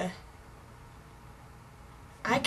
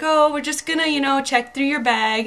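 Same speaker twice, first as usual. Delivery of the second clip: lightly voiced nice lady, higher and louder; nice English lady; 6 semitones higher, 7 dB louder than usual. English lady at customs telling a traveler about a bag check, lightly imitated, nice and higher. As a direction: pitch up into a mild imitation of a friendly officer. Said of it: "in her English accent, accent, of course".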